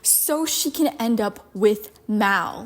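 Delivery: ordinary delivery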